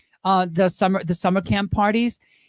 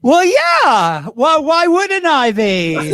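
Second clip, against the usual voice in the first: mock indignant; 8 semitones higher, 6 dB louder, much slower than usual. Mock-indignant reply to bingo jab.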